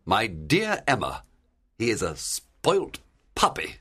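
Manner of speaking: disapproving gentleman's character voice; mildly exasperated gentleman; understated anger. Acted. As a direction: deliver a scornful verdict without raising the voice. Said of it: "Mister Knightley was furious".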